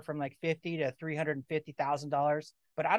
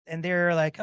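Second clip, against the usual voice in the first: ordinary talk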